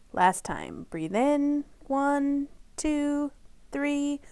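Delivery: gentle counted breathing guide; soft counting for a child's breath; breathing exercise count. Acted. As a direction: count the inhale softly and evenly.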